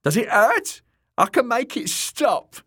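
high, loud sneering jester voice; mocking jester. A narrator's reading. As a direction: taunt the wounded man. Shagwell taunting the wounded man.

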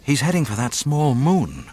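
ordinary delivery

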